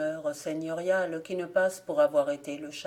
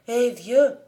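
called out higher and louder; calling out; 6 semitones higher, 9 dB louder than usual. Acted. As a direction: hail the old man from the riverbank. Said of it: "cria-t-elle".

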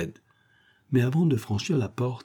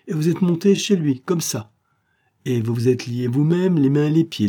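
higher, incredulous interrogation; exasperated sarcasm; 5 semitones higher, 4 dB louder than usual. A narrator's reading. Policeman questioning how he tied himself up, exasperated. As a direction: throw the absurd story back at him. Policeman mocks an absurd account.

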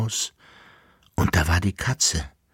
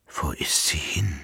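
unvoiced, much softer whisper; 4 semitones lower, 8 dB quieter than usual. A narrator's reading. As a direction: breathe the question, lost. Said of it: "flüsterte der Kaiser". (flüsternd, verloren)

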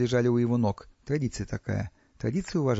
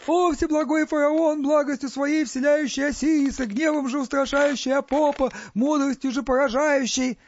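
very high, sustained chanting wail; wailing invocation; 13 semitones higher, 4 dB louder than usual. A narrator's reading. Warrior prostrate before the pharaoh, wailing his praises. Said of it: "заголосил".

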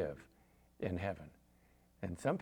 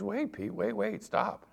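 mock objector; 11 semitones higher than usual. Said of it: "you might be saying".

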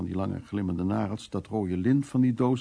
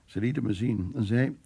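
ordinary delivery

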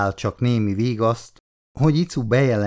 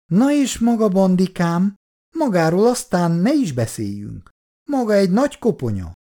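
higher, sugary voice of a chatty aunt; gushing older lady; 8 semitones higher than usual. Acted. Olgi néni flattering Bondi about his wife, gushing. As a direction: gush over his wife with sweet flattery.

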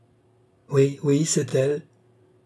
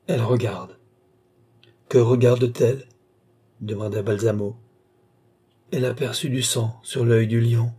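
ordinary delivery, nothing marked

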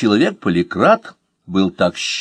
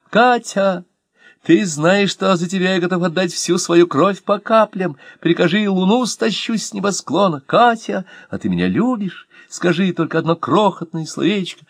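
put-on ardent young lover; 9 semitones higher than usual. Young lover declares love to Katya.

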